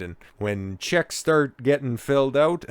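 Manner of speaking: ordinary talk, nothing marked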